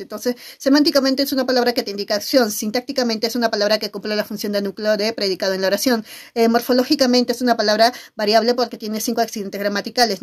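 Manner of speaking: rapid, rattled-off definition; fast review. Shown as a demonstration. Teacher giving a quick grammar review, fast delivery. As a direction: race through the definition clearly. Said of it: "pero así, rápido".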